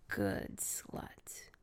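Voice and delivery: deep, breathy praise whisper; low whisper. Acted. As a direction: murmur it low and smug.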